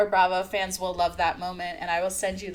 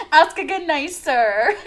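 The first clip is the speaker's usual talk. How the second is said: sassy demand; 4 semitones higher, 8 dB louder than usual. Retold or imitated. S1 plays sassy Lizzie, loud.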